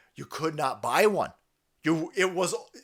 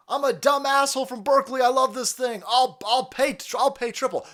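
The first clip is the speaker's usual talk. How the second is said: mock Berkeley guy; 8 semitones higher, 4 dB louder than usual. Mocking a Berkeley EV owner.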